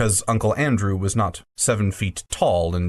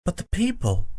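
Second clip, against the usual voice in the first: put-on higher, shocked gasp of a boy; 7 semitones higher than usual. Diggory horrified at the Queen's deed, gasping. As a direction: gasp it out in horror. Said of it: "gasped Diggory".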